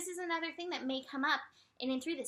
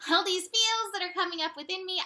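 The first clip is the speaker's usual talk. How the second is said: high, loud outpouring of feelings; acted emotional outburst; sudden overwhelm; 6 semitones higher, 7 dB louder than usual. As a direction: let it burst out, pitched up. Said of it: "suddenly you're like".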